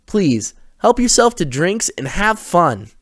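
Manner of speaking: very high, slow sugary welcome; perky hosts. A narrator's reading. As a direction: overeager young hosts. Mason and Harper voiced.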